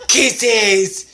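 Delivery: drawn-out cooed word. Real, not acted. To friend's dog on camera, cooing.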